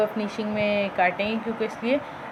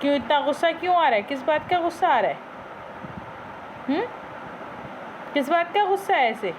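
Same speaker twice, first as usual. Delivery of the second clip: higher, play-scolding a naughty parrot; pretend-stern owner scolding her parrot; mock scolding; 5 semitones higher than usual. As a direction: tell off the pet in mock anger, half teasing.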